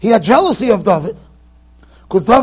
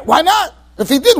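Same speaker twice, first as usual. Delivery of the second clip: ordinary delivery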